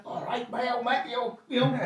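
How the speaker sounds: a hint of a cheery London Cockney; Cockney accent. Tottenham fan greets the landlord in the street: light Cockney. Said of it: "his Cockney accent".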